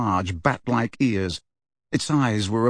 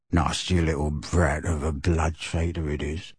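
hoarse bullfrog mutter; 8 semitones lower, 5 dB quieter than usual. A narrator's reading. Old house elf grumbling to himself, low croaking mutter.

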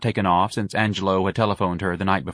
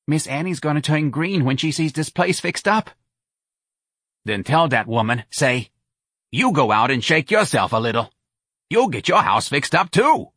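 a lightly voiced brassy New Orleans woman, a bit higher; Santa's voice; 6 semitones higher than usual. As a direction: push Irene to call the charity, lightly in character. Santa gloats on phone.

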